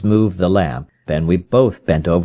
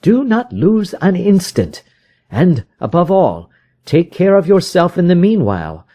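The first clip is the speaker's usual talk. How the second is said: higher, pressing detective's voice; urgent Holmes; 10 semitones higher, 3 dB louder than usual. A narrator's reading. Holmes urges the frightened visitor, higher and pressing. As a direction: rouse a despairing man to action.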